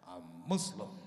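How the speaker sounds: very low, loud declaration. Retold or imitated. Revealing his faith to the lady, deep solemn voice. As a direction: announce it deep and ominous.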